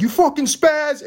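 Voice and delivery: high, slow mocking taunt; mock insult. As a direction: sneer it as a bully.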